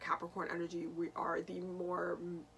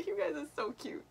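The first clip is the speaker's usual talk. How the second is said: higher, louder, laughing through the words; laughing fondly; 10 semitones higher, 6 dB louder than usual. Real, not acted. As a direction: giggle while you gush. Gushing at Venus Capricorns.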